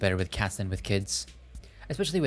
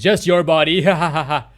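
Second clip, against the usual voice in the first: menacing joke then laugh; 6 semitones higher, 12 dB louder than usual. Acted. Joking about burying bodies.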